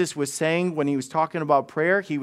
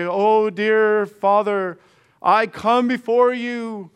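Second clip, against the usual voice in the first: slow, grand, higher churchy voice; pompous showy prayer; 3 semitones higher, 4 dB louder, much slower than usual. Retold or imitated. Preacher mocking Pharisees praying for show, slow grand voice. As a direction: ham up a pompous prayer, drawn out. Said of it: "the fancy words".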